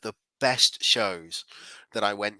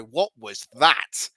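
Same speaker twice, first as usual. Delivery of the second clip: ordinary talk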